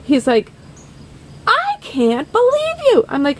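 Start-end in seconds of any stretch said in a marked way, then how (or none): 1.4-3.0 high, hammed-up exasperation; exasperated disbelief. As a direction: wail it in mock disbelief. Producer exasperated.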